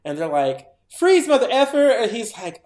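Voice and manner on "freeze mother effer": high, loud barked order; mock police shout. Police confront Art eating her face, mock police shout. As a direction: yell it like a cop bursting in. Acting police arriving on scene.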